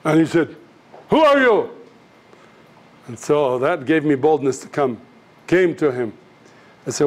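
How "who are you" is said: high, indignant retort, acted out; angry challenge. Wife-beater challenges the man who interrupted, angry. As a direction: play an attacker challenging an intruder.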